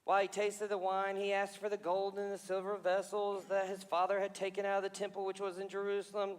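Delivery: normal talking voice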